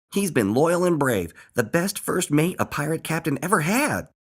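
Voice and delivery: high, animated character voice; proud testimonial, pitched well up; Earl defending a friend. A narrator's reading. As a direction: speak up loudly in a friend's defence. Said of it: "replied Earl".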